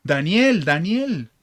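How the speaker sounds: calling out a name in loud distress; anguished call. Retold or imitated. King calling down into the lions' den at dawn, anguished loud call. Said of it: "grita angustiado".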